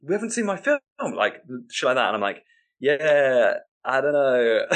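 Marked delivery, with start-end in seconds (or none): 0.0-0.8 mock indignant heckle; imitated outraged shouting. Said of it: "people shouting". 2.8-4.7 loud, raised, drawn-out shrugging reply; higher, louder mock-helpless answer; helpless agreement. Host helplessly answers angry crowd.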